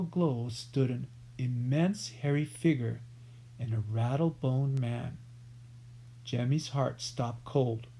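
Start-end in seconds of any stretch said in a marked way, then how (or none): none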